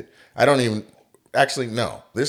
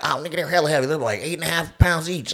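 excited thief; 6 semitones higher, faster than usual. Retold or imitated. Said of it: "were like".

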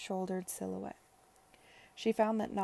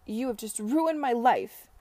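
loud, slow, raised-pitch outrage; furious accusation; 4 semitones higher, 8 dB louder than usual. A narrator's reading. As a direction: drag it out as a shouted accusation. Rejected lover's furious accusation.

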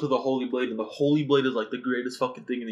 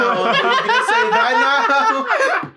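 high squeaky laughing tease; 8 dB louder, much slower than usual. Real, not acted.

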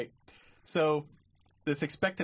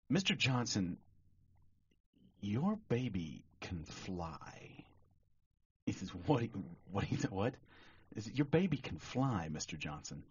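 softer and lower, breaking odd news gently; hushed, grave doctor; 5 semitones lower, 7 dB quieter than usual. Acted. Doctor to anxious father, hushed and grave. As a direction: lean in and tell him quietly.

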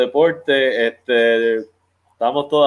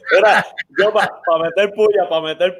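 laughing; 7 semitones higher than usual. Real, not acted. Laughing at the teasing.

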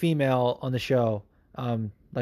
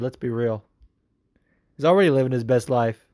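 normal talking voice

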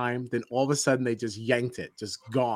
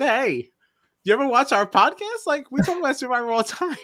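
higher, brighter retold greeting, a little faster at the end; upbeat retold question; 12 semitones higher, 4 dB louder than usual. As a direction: sound eager asking about the podcast. Retelling own message to Matt.

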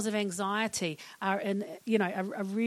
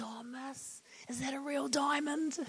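softly voiced awed schoolkid, rising at the end; imitated pupil; 4 semitones higher, 8 dB quieter than usual. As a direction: ask teacher in a hushed, curious kid voice. Voices a curious pupil asking teacher.

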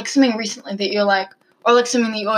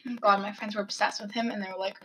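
ordinary delivery

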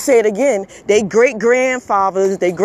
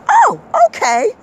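high, sarcastic "oh, okay" pitched way up; mock okay; 12 semitones higher, 3 dB louder than usual. After being told to leave, a high mocking okay. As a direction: play it like a put-on shrug of fake acceptance.

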